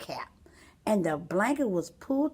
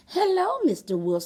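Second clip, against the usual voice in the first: put-on high, bright little girl greeting; 10 semitones higher, 5 dB louder than usual.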